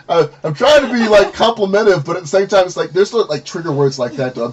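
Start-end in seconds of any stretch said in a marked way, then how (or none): 0.1-2.7 laughing. Real, not acted. Laughing at the awkward compliment.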